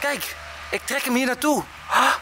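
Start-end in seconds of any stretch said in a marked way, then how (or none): none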